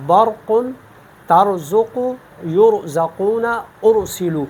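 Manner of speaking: برق and ترزق with a thick, full R; heavy ra. Shown as a demonstration. Teacher showing ra after zabar or pesh, heavy ra. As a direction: say the ra in یرزقون heavy, with rounded tongue-back. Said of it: "بھی پر پڑھتے ہیں".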